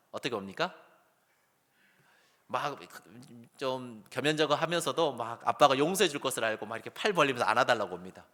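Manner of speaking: ordinary talk, nothing marked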